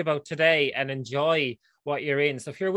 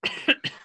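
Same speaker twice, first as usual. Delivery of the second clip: choking cough. Real, not acted. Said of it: "Oh, Jesus, he's dying".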